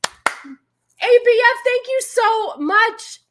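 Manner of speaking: high, loud, slow gushing thank-you; excited thanks. Real, not acted. Streamer thanks a viewer for donating, gushing. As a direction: stretch it out, thrilled.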